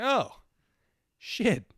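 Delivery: high, loud startled realization; a sudden oh-no moment; surprised. Retold or imitated. Learns friend was diagnosed.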